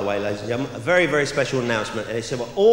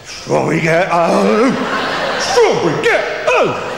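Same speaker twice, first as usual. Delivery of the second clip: slow, drawn-out, much higher and louder cry; climactic shout; 5 semitones higher, 6 dB louder, much slower than usual. Retold or imitated. Comic climax, man crying out his dessert, slow and loud. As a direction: stretch it out like a climax.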